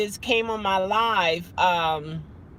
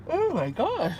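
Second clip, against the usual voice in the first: words stretched by a real yawn; 7 semitones higher than usual. Real, not acted. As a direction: say it through a yawn. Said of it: "Whew, I'm yawning".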